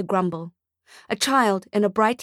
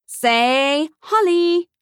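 high, slow, drawn-out cue; cheesy photographer; 8 semitones higher, 5 dB louder, much slower than usual. Acted. Photographer to Nick and the boy, slow and cheesy. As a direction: stretch it like a camera prompt.

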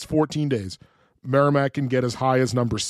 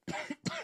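cough; 16 dB quieter than usual. Real, not acted. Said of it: "I'm choking on my water".

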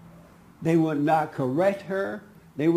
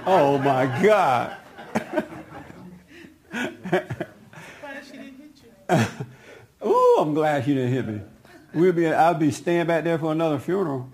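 talking through real laughter; laughing. Real, not acted. Recalling the near fight with relief, laughing. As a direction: exclaim and joke while cracking up.

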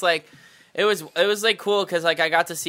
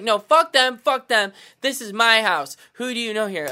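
loud, high furious demand; angry yelling; 7 semitones higher, 5 dB louder than usual. Retold or imitated. Angry host yelling at a party guest. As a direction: bark it at him like a bouncer.

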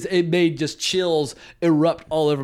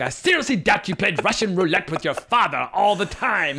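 higher-pitched, precisely enunciated haughty put-down; malicious, sophisticated English diction; 4 semitones higher than usual. Retold or imitated. Husband scorning his wife's roulette story, crisply malicious. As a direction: play a withering English husband with clear diction. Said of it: "his use of diction is so clear".

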